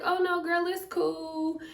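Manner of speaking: high, easy brush-off of an apology; breezy reassurance. Retold or imitated. Retells friend brushing off apology.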